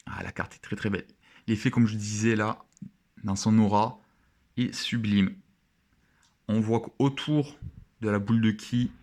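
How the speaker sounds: usual speaking voice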